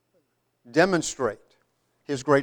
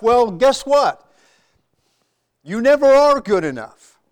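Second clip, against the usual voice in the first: emphatic preaching; 6 semitones higher, 5 dB louder than usual. Real, not acted. Preacher drives home a point.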